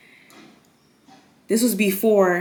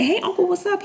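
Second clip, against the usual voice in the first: fake casual greeting; 12 semitones higher than usual. Answering uncle's call, forced casual.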